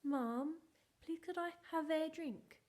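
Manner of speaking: put-on a sweet asking child; sing-song child asking. Shown as a demonstration. Child asking mum for a drink, sing-song. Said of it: "He wants us to sound silly".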